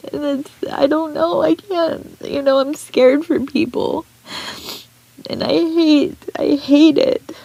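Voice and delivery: high, tearful, breaking voice; talking through real tears; crying. Real, not acted. Vlogger to her viewers, still crying. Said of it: "will not apologize for crying".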